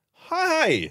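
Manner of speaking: high, startled, confused hi. Retold or imitated. Troll turning round at the bar, confused. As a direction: caught off guard, unsure who you are. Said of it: "in a sort of, uh, confused way".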